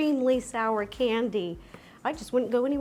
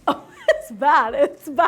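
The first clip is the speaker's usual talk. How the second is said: laughing; 6 dB louder than usual. Real, not acted. Reacting to extreme sour candy.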